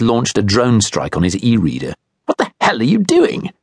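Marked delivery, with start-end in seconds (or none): 2.3-3.5 much higher, indignant demand; a self-righteous man rounding on Dad; high, outraged question rising at the end; voiced angry stranger. A narrator's reading. Guy confronts Dad angrily.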